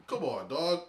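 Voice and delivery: loud, high-pitched pleading objection; exasperated protest. Real, not acted. Teased man appealing to his friends, exasperated protest. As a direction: plead your case, voice jumping up.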